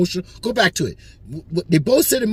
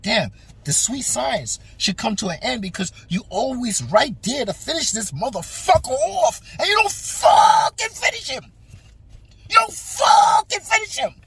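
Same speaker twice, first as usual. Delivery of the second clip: frustrated yell; 6 semitones higher than usual. Retold or imitated. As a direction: build to a shout at the fighter who won't finish. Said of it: "I was like".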